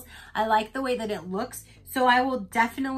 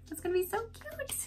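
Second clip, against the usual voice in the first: squeal; 11 semitones higher, 8 dB quieter than usual. Real, not acted. Excited over cute jellyfish.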